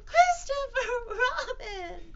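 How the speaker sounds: slow, very high loud wail; drawn-out cry. Acted. Piglet appealing to Christopher Robin, long wailing cry. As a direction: stretch each syllable of the name in a squeal. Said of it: "cried Piglet".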